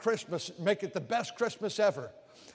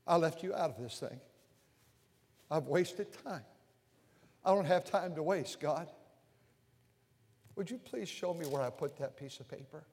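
retold humble prayer; 2 semitones lower, 5 dB quieter than usual. Preacher retelling asking God to find the paper, soft and humble. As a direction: pray it quietly and humbly. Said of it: "I'll say".

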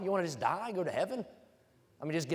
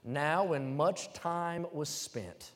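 slow scripture reading; much slower than usual. Acted.

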